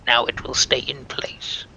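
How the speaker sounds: slow, unvoiced ghoul whisper; ghoulish whisper. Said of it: "stick with a ghoulish voice".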